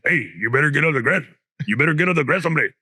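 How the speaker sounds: a lightly voiced barking guard; security guard impression. Imitating the school security guard yelling at kids, lightly voiced. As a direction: boss the kids off the grass. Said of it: "he had a voice that sounded like this".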